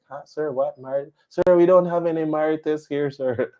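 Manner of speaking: high-pitched puzzled clerk voice; baffled store clerk. Retold or imitated. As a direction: imitate a confused clerk apologizing to a customer. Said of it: "the face is like".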